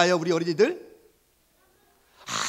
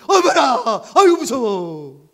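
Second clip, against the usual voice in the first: mock fright; 5 semitones higher, 10 dB louder, slower than usual. Passengers frightened in storm.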